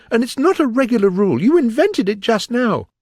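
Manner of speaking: high, loud, fast objection; indignant protest. A narrator's reading. Alice accusing the King, high and indignant.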